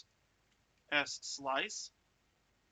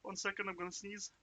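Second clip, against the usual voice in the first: ordinary talk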